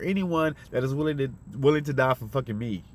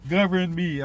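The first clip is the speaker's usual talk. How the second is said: higher, whiny mock plea to be ruled; mocking a meek voter; 6 semitones higher than usual. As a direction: parody voters begging to be governed. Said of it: "that's what you're saying when you're voting".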